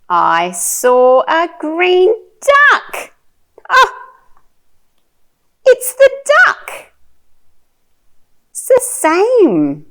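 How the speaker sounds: high, loud, slow delight building upward; excited discovery. Shown as a demonstration. Showing a toddler the same duck, excited. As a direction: marvel at finding it, gasping "oh".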